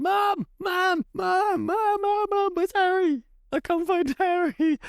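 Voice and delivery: put-on a small child yelling to mum about the lost hamster; frantic shouting child. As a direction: shout for Mom in a panic.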